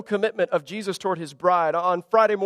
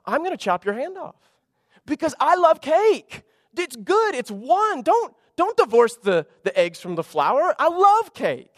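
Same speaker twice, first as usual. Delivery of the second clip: much higher, playful possessive exaggeration; mock fierce cake lover; 8 semitones higher than usual. Preacher to congregation, hammed-up cake guarding. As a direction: ham up guarding your cake.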